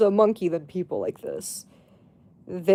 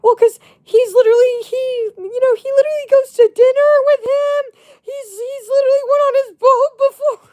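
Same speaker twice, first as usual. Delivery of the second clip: much higher, louder gossipy friend; imitating friend; 7 dB louder than usual. Imitating the friend explaining her suspicion, high and loud. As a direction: sell the flimsy proof, high-pitched.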